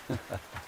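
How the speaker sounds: a short breathless chuckle under the breath; soft chuckle. Acted. Old friend chuckling softly to the traveler, a quiet chuckle. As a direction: let out a quiet, amused breath of a laugh. Chuckles while chatting with companion.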